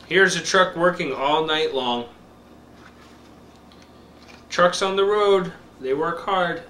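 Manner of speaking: slow, bright picture-book reading; reading to a toddler. Acted. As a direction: read it slow for a little one.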